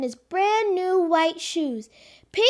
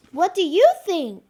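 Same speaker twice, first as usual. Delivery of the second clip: ordinary delivery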